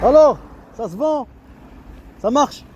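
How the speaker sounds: loud, high joking call. Real, not acted. Teasing passers-by about business, called out loud and high. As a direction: shout it across to them. Calling out to people nearby.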